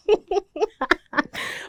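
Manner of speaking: big high laugh; cracking up out loud; loud burst of laughter. Real, not acted. Laughs at failed Pidgin.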